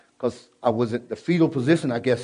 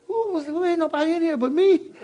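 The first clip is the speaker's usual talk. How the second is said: much higher, bewildered; a man who hears a voice in an empty room; startled. Retold or imitated. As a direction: look around, confused that nobody is there.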